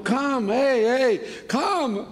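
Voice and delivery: high-pitched urgent summons; imitated calling out. Man summoning all the store's workers, high excited call. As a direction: imitate calling everyone over from across a shop. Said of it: "él llamó a todos los trabajadores".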